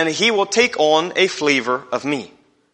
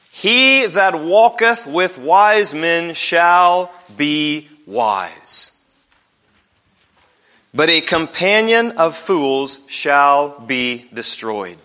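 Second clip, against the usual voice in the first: slow, measured reading from Proverbs; slow scripture reading; much slower than usual. Acted. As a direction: read each word slowly and deliberately. Reading the verse aloud.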